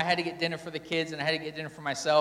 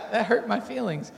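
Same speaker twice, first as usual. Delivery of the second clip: higher-pitched, laughing through it; laughing admission; 6 semitones higher than usual. Real, not acted. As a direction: own up to it with a real laugh. Laughs while admitting hurt feelings.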